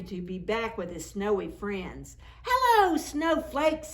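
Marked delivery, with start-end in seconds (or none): 2.4-3.9 high, loud happy greeting; shouts. Acted. Larry greeting his snowy friends, high happy shout. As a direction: call out to the snowflakes. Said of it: "he shouts".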